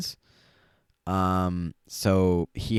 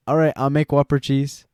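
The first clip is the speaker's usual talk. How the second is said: louder Filipino-accented order; 4 semitones higher, 5 dB louder than usual. Shown as a demonstration. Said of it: "I'm accidentally using a Filipino accent".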